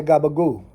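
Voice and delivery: "Gabagoo" said GAH-buh-goo, final vowel dropped; Jersey gabagoo. Shown as a demonstration. Showing how Jersey folks order at the deli, saying gabagoo. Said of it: "They're gonna say Gabagoo".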